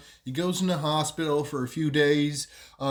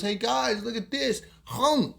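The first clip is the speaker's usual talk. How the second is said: higher-pitched boast to mates; show-off call; 7 semitones higher than usual. Retold or imitated. Show-off Wado to his mates, cocky call. Show-off voiced calling to friends.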